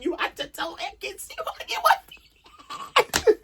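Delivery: slow, high challenge dissolving into laughs; breaking into laughter. Real, not acted. Vlogger mocking Curtis's confidence, breaking into laughter. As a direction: lose it laughing by the end.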